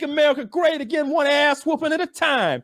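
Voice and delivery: high, laughing mock swagger voice; mocking Colby boast. Mocking the fighter's MAGA persona, high hammed-up brag. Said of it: "And he was like".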